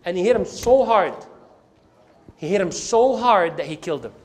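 usual speaking voice

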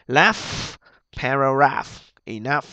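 "Laugh, paragraph, enough" spaced out, each ending in audible f; final F sound. Shown as a demonstration. Teacher showing students gh read as F, crisp final sound. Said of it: "nó phát ra âm F".

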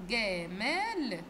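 "جمال" stretched out very slowly; slow sounding-out. Shown as a demonstration. Teacher recapping the word's letters for pupils, "جمال" said very slowly. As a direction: draw out each syllable of "جمال" for young learners. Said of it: "هننطق بالراحة".

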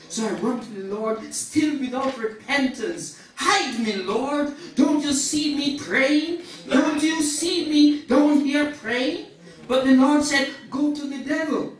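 high, forceful storytelling from the pulpit; impassioned preaching. Acted. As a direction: act out the sinner's flight with fervour.

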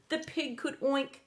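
plain reading voice

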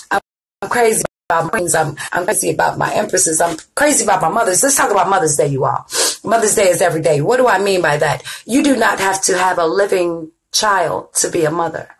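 normal talking voice

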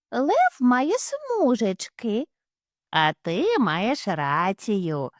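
slow, drawn-out hare talk; slow silly dialogue. Acted. The hare parents discuss stripes, slow and silly. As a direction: drag the words like dim hares.

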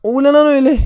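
high, loud startled cry; surprised shout. Real, not acted. Gamer spotting a tiny pet, high startled shout. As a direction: yelp at the surprise.

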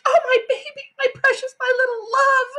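high, gushing mother's cooing; doting mother. A narrator's reading. Mother picking up her hurt son, high anguished cooing. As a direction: coo over the child in distress.

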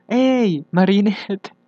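hammed-up voice-acting callout; quoting a fandub line. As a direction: replay your old dub line as the character, calling out. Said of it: "Hasta aún me sé las líneas".

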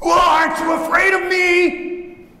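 high, slow, full-force bellow; wolf's roar. Acted. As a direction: roar it like an affronted wolf. Roaring wolf challenges the sheep.

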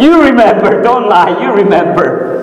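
high-pitched imitation of her insisting; insistent accusation. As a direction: press the point as the accusing woman.

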